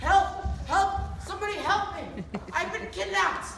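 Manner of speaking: high, loud cries for help; panicked screams. Acted. Leprechaun screaming as the customers grab him, high and loud. Shouting while being grabbed.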